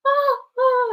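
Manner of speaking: high, loud overwhelmed cry. Retold or imitated. Meeting her friends in space, a loud high cry. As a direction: cry out at seeing old friends. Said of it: "and I'm crying".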